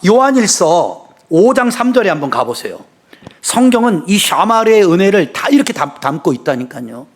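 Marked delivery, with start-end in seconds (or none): none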